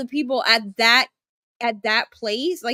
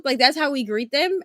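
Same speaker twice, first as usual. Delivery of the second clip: usual speaking voice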